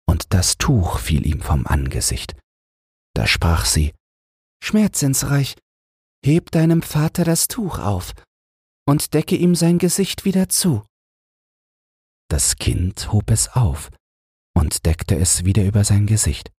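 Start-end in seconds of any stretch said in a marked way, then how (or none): 4.6-10.8 high, gentle voice of the poor queen; the queen asks the angel, in a high gentle voice. A narrator's reading. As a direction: play the humble queen asking in wonder.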